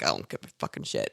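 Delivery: deep, fast, whispered and blunt; low whispered don't-care voice. Shown as a demonstration. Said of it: "And then, like, younger people, I'm like".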